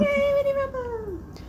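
high, bright mock cheer; cheery yay. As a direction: cheer it like a game show. Cheering the wrap-up segment.

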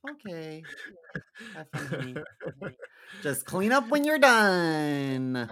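mock partner voice. Voicing partner's dismissive reply.

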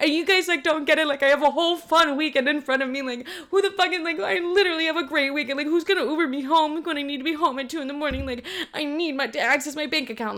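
high-pitched panicky whine; imitated tearful frantic complaining; freaking out. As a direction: wail it like a hammed-up meltdown. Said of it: "I'm just freaking out about that".

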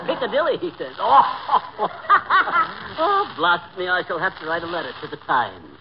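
hammed-up British chortling; mock-British gentleman tickled by a pun; awful British accent. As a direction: laugh it up in a put-on British accent. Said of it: "you have no idea how awful you sound".

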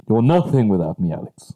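rasping gasp then a menacing line; heavy mask breath, then threaten; Darth Vader breathing. Pretend. Host playing Vader to his friend Alex, heavy breathing. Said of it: "breathe like Darth Vader".

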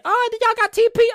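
high, loud mock panic; mock texting friend. Host imitating people texting for toilet paper, high mock urgency. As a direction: squeal it like a frantic friend. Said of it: "they said".

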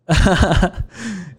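high burst of laughter; high laugh trailing down. Real, not acted. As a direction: crack up at your own story. Laughs at his own story.

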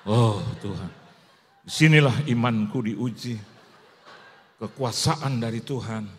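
loud, slow mock-fervent prayer; doa keras. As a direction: cry out to God in a booming retold prayer. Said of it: "saya doa keras dalam nama Yesus". (doa lantang penuh semangat, diperagakan)

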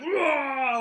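high, loud playful growl; mock roar. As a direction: roar like a monster to scare her in fun. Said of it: "I was like".

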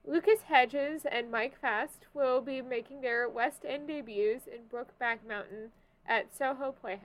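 ordinary talk, nothing marked

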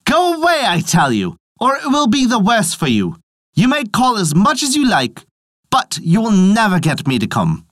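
high, angry defiant warning; calling out. A narrator's reading. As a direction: call it out high, warning off a tormentor. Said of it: "he called out again".

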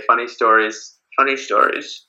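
normal talking voice

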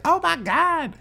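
high-pitched, put-on horrified cry; mock horror. Woman running in on the body, mock horrified. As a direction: imitate shock in a high voice.

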